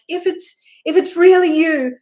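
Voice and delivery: high, loud challenge into the dark; daring shout. Retold or imitated. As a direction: shout a dare at the ghost. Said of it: "and I'm like".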